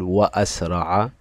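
"وأسرع" drawn out as wa-asra'a; hamza pronounced. Shown as a demonstration. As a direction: clear glottal stop after wa in "وأسرع". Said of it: "ظهرت معي الألف في النطق". (نطق «وأسرع» ببطء وبهمزة ظاهرة)

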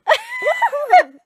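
high squeal of laughter; loud shrieking laugh. Real, not acted. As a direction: burst out shrieking. Laughing at accent attempt.

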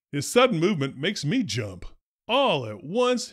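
ordinary delivery

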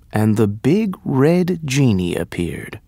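ordinary delivery, nothing marked

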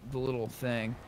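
ordinary talk, nothing marked

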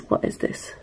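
a soft puzzled whisper under the breath; muttering, wondering what this is; whispered to herself. Real, not acted. Puzzling over plate to herself.

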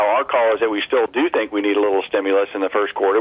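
ordinary talk, nothing marked

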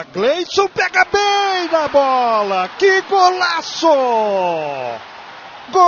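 rising to a shouted goal call; explosive goal commentary. Acted. Narrator calls Gleison goal.

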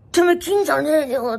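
mock the caller's menacing growl; imitated thug threat. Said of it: "とか言って".

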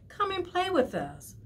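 high, coaxing invitation; a light touch of little fish voices; calling friends. Acted. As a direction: lure him into playing. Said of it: "they would call".

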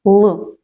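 Л said as a hard, dark L with no y-glide. Shown as a demonstration. Teacher sounding out the first sound for children, hard L in Л. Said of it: "це твердий звук".

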